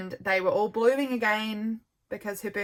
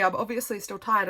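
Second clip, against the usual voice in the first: ordinary delivery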